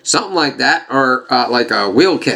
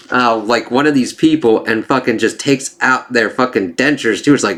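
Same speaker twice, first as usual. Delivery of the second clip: ordinary talk, nothing marked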